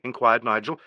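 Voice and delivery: usual speaking voice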